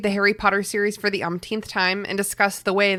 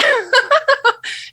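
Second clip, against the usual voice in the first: mean laugh; 4 dB louder than usual. Shown as a demonstration. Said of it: "It comes out mean every time".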